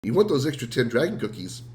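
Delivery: ordinary delivery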